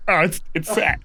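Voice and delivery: half-hammed, frog-in-the-throat grief; a choking, tearful wail over a sad film; choked-up sadness. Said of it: "choking on just, like, pure sadness".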